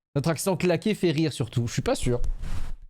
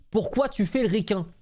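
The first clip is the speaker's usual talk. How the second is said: mocking classmate; 6 semitones higher than usual. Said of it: "tu lui dis".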